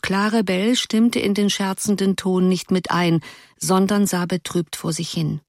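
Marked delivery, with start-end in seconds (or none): none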